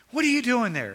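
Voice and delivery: loud, high parental scolding; condemning scolding. Acted. Parent scolding the kids, condemning shout. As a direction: yell it like a cross parent catching a kid. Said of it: "God's not coming to you in a con-, condemning".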